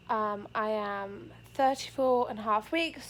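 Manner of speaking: normal talking voice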